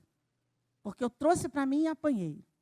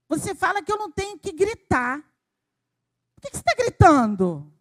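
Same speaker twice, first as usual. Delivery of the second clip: put-on loud, indignant child's challenge, high start and falling end; child's reproach; 4 semitones higher, 6 dB louder than usual. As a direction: imitate a son calling out his mother. Voicing son's reproach to mother.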